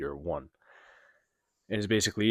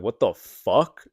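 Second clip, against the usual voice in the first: loud, drawn-out retold disbelief; stunned disbelief; 3 semitones higher, 11 dB louder, much slower than usual. Recounting his reply to the stranger's question, stunned disbelief. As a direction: blurt it slowly and loudly, dumbfounded.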